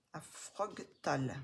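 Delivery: "À Frogtal" said slowly, French vowels and a French R; "Frogtal" read as if it were a French word, slowly. Shown as a demonstration. Reading an English book title aloud, said the French way. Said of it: "je le dis à la française".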